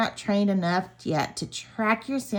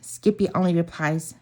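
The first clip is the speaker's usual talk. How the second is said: plain reading voice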